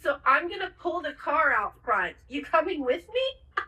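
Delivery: Steven. Retold or imitated. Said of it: "Steven says".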